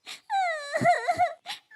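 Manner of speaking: very high, loud acted wail; high-pitched crying. Fallen little bird in the bushes, crying. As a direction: bawl like a hurt little child. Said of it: "रोने लगती है".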